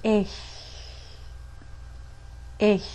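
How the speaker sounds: ich with a soft whispered-yes ch; ich, ch breathed like the y in a whispered yes; correct ich. Shown as a demonstration. Teacher modelling ich for students. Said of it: "try to say the German word ich".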